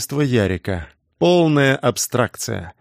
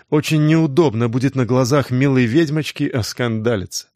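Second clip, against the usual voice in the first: usual speaking voice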